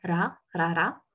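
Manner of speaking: "ra, ra, ra" with Korean tapped R; Korean R sound. Shown as a demonstration. Teacher showing learners the official sound, "ra" with tapped R. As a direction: tap the tongue for each "ra". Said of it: "officiellement, on va dire, le son ra".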